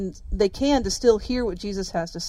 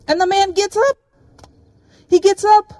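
excited exclaim; 10 semitones higher, 7 dB louder than usual. Real, not acted. Climax of healing.

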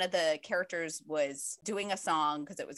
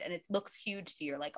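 ordinary talk, nothing marked

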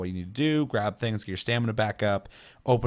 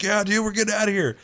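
very high mock-jubilant teammate; excited imitation; 11 semitones higher, 4 dB louder than usual. Escaping player answers his driver over walkie-talkie, excited imitation.

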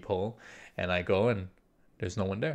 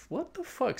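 high, soft, bewildered question; softly squeaked disbelief, retold; hushed disbelief; 7 dB quieter than usual. Reacting to knocking out of nowhere, hushed disbelief.

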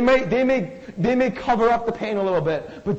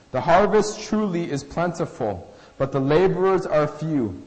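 measured, lower Bible reading voice; scripture reading; 5 semitones lower than usual. Acted.

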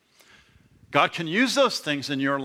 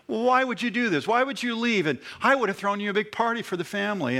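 much higher, fast, whiny mock protest; mock wounded Laban; 7 semitones higher, faster than usual. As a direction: mimic the swindler's fake hurt. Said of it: "He's a con man".

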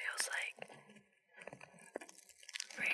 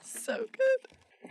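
blissful sigh; 8 dB louder, much slower than usual. Acted. Savouring the paper sound.

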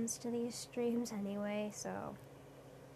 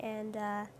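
talking with hair caught in mouth; 3 semitones lower, 9 dB quieter than usual. Real, not acted. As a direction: spit out a stray hair mid-word. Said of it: "I got hair in my mouth".

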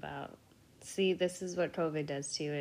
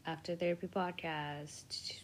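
whispered podcast title; 6 dB quieter than usual. Acted. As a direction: breathe the show name, no voice.

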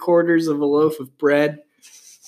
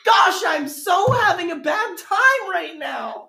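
high whiny mock of her tweet; 13 semitones higher than usual. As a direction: whine it like a bratty teen. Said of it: "you said".

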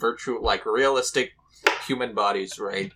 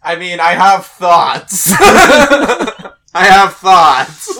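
loud, laughing boast; 7 semitones higher, 13 dB louder, much slower than usual. Real, not acted. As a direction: burst out laughing. Laughing loud claim of thoughts.

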